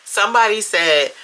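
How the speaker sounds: normal talking voice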